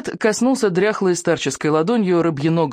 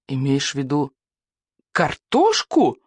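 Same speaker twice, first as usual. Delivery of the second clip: shocked gasp. A narrator's reading. Viking dares name the forbidden vegetable, gasping. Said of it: "ахнул Икинг".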